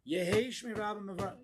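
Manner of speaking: loud, high proclamation; emphatic, no whispering. Shown as a demonstration. Rabbi praising the king in shul, loud and emphatic. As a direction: belt out the response as if you believe it. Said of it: "You're gonna whisper that?".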